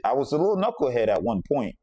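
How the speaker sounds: ordinary talk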